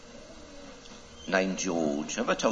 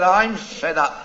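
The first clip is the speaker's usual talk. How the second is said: louder, slow, drawn-out moan; sulky goldfish; 6 semitones higher, 5 dB louder, slower than usual. Acted. Goldfish complaining to the fly, loud slow sulky moan. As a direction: drag it out like a fed-up sulk.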